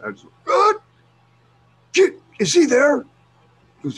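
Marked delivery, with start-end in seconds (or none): 0.4-0.8 very high, loud shriek of disbelief; outraged dad's yell. Retold or imitated. Dad reacting to the officer's call, outraged shriek. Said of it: "I start going like that". 1.9-3.0 high, loud angry demand; outraged dad's yell. Retold or imitated. Dad demanding his son from the cop, angry yell. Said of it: "I start going like that".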